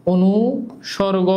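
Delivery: "অনুসর্গ" drawn out in two parts, pausing after অনু; slow "অনুসর্গ" with a gap mid-word, for note-takers; slow dictation. Shown as a demonstration. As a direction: dictate "অনুসর্গ" slowly, breaking it as অনু, সর্গ. Teacher repeats word slowly for dictation.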